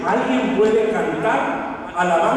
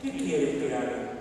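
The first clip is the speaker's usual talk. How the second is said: sudden drop to a low, soft question; fearful, humbled; 5 semitones lower, 9 dB quieter than usual. A narrator's reading. Saulo trembling before the Lord, low hushed question. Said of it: "Él temblando y temeroso dijo".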